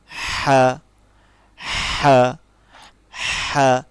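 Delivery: loud airy ha from the throat; small ha. Shown as a demonstration. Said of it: "যেমন".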